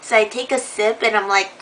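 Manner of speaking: ordinary talk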